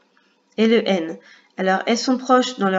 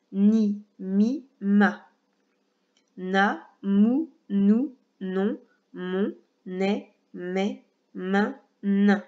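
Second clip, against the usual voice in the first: détache chaque syllabe clairement pour l'élève; lecture modèle; much slower than usual. Shown as a demonstration. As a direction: lecture lente et articulée de syllabes, une à une. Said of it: "c'est à mon tour". (lecture de syllabes bien articulée, pour un enfant)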